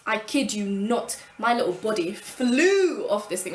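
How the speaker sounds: slow it down and land the drama; slow, emphatic build to the fall. Real, not acted.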